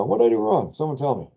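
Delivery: exasperated high question. Real, not acted. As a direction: pitch jumps up high, exasperated and puzzled. Teacher stuck on own Excel error.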